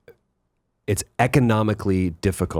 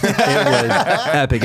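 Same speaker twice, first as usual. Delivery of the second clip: drawn out slow and louder through a laugh; 4 semitones higher, 5 dB louder, much slower than usual. Real, not acted. Bragging to the host about his stew, laughing slowly. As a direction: laugh it out, proud and amused.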